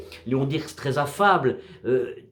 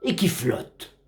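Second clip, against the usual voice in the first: slow, solemn verse reading with measured pauses; poem recitation; 3 semitones lower than usual. Acted. Narrator recites a sea poem about a drifting wreck, measured recitation. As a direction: recite it as poetry, line by line, falling at the ends.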